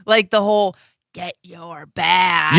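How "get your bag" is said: slow, lower, drawn-out mock order; passive-aggressive order. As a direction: imitate a passive-aggressive dad, word by word. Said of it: "you're passive aggressive".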